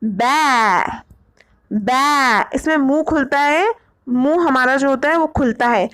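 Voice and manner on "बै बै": बै said with an open ai and a light ya glide at the end; बै with a faint ya sound at its end; pron demo. Shown as a demonstration. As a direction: open the mouth wide for बै. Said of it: "वो क्या होगा?".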